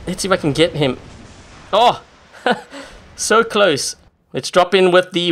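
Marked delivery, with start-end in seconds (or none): 1.7-4.0 excited. Real, not acted. Near miss in game.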